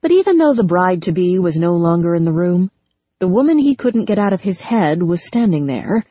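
usual speaking voice

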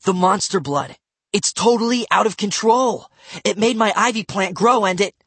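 high, loud, alarmed warning; urgent alarm. A narrator's reading. Matt warns Bradley about monster blood, urgent and alarmed. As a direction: spill the bad news urgently.

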